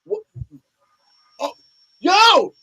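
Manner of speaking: loud, high, surprised cries; shocked delight. Retold or imitated. Kendall seeing Carly win, loud and amazed. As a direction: gasp it out in disbelief. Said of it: "She's like".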